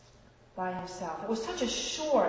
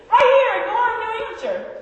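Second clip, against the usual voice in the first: excited; 12 semitones higher, 11 dB louder than usual. Real, not acted. Excited emphasis after laugh.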